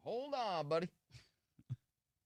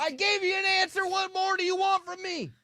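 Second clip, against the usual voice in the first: shouting; 7 dB louder than usual. Pretend. Mock rant as Nard.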